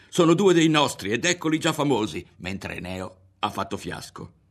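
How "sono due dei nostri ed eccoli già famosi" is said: higher and louder, triumphant rush of joy; exulting. A narrator's reading. Ario to himself, exulting over the famous boys, higher and louder. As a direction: cry it out as if the news were a victory.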